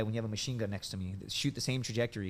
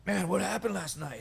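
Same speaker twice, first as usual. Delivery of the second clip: groggy hungover; 7 semitones higher than usual. Retold or imitated. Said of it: "he's like".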